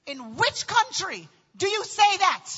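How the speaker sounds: slow, emphatic disbelief; indignant retort. Retold or imitated. Daughter answering dad's tactless compliment, indignant retort. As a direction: spell out the outrage, slowly.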